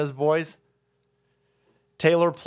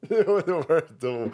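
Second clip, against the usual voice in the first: uncomfortable laugh. Real, not acted. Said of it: "That's an uncomfortable laugh I'm doing".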